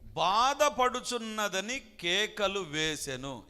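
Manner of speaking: slow and loud, leaning hard on బాధపడుచున్నదని; preacher hammering the verse home, slow and loud; drawn-out emphasis. Acted. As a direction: stretch out బాధపడుచున్నదని and let కేకలు వేసెను ring. Preacher re-reads verse with weight.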